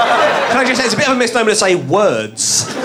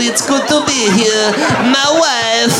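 hammed-up Brazilian accent, pitched up; mock Brazilian accent; 4 semitones higher than usual. Host mocking the actress's attempt with a bad Brazilian accent. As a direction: tease her by parroting a bad Brazilian accent. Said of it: "hear your Brazilian accent again".

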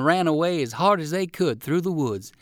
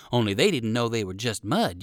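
usual speaking voice

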